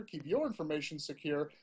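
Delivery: normal talking voice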